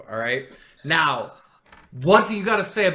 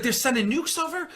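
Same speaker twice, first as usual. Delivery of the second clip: high, alarmed disbelief; frightened question; 9 semitones higher than usual. Retold or imitated. Retelling the prank, voicing the gamer's frightened question. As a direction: ask it like bombs are coming.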